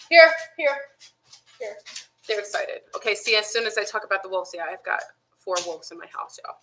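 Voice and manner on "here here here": calling the dogs. Real, not acted.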